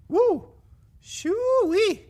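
high, loud hooting at a bad smell; mock disgust whoop. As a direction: whoop like the skunk stinks. Reacting to skunk stink. (grito burlón de asco por el olor del zorrillo)

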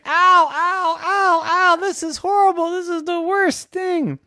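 put-on high wailing kid, loud at first; distressed yelps.

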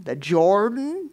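"The Jordan?" drawn out, climbing high in disbelief; incredulous rant. Acted. Naaman ranting about dipping in the river, incredulous. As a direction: stretch "Jordan" slowly into a scornful question. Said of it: "on his rant and he said".

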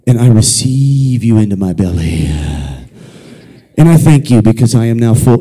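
a hint of a hammed-up believer; mock prayer. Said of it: "We look at the ice cream and say".

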